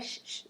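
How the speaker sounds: "sh-, sh-" as a soft isolated hiss; hushing SH sound. Shown as a demonstration. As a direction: demonstrate the chiado with a soft "sh-, sh-". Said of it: "do chiado".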